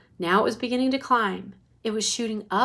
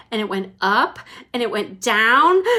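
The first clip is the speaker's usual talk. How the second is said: usual speaking voice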